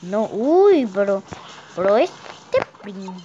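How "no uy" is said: normal talking voice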